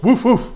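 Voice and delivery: high, loud mock woofs; imitated dog bark. Trainer voicing the dog's answer to the cue, imitated barking. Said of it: "And the dog goes".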